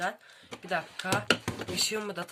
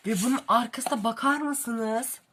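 excited; 4 semitones higher, 6 dB louder than usual. Real, not acted. Unboxing a beautifully designed book.

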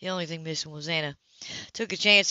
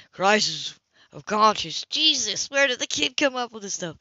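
incredulous; 4 semitones higher, 6 dB louder than usual. Acted. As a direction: repeat it in disbelief, then blurt out Jesus. Incredulous repeat.

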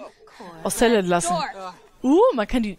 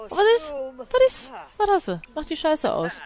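high alarmed; 7 semitones higher than usual. Acted. Schimpft mit weinendem Sim.

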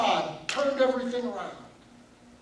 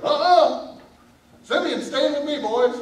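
stubborn father; 6 semitones higher, 8 dB louder than usual. Acted. Dad refuses.

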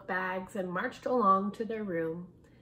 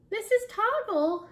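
high, louder call across the room; yelling; 12 semitones higher, 6 dB louder than usual. A narrator's reading. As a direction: call out to the teacher. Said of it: "yelled Joey".

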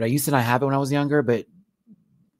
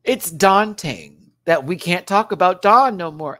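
loud, slow, hammed-up lament; mock wailing; 5 semitones higher, 7 dB louder, slower than usual. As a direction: wail it out in pretend grief. Mock grief, punning on Dawn.

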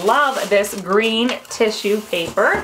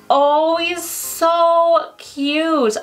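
gushing; 5 semitones higher, much slower than usual. Real, not acted. Gushing over ornament.

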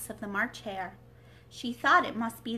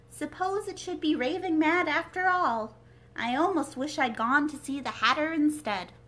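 higher, louder, worried Alice; nervous Alice; 5 semitones higher, 6 dB louder than usual. Acted. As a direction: fret aloud as she creeps closer. Timid Alice near house.

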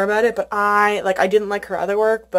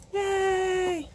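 high, soft happy squeak of yay; little yay; 10 semitones higher, 8 dB quieter than usual. Real, not acted. Collector happy about her pre-order stickers, a small high yay. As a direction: squeak a tiny cheer.

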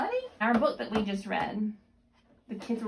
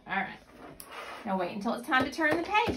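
ordinary talk, nothing marked

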